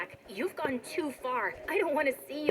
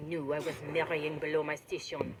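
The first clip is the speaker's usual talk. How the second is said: lower, haughty, disdainful; cold rich groom; 8 semitones lower than usual. Acted. Sean dumping the bride before the guests, lower haughty scorn. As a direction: look down on her from your station.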